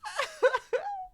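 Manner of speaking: loud high cackle; high laughing fit. Real, not acted. Streamer losing it over the catty cards, laughing fit. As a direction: let the laugh burst out high.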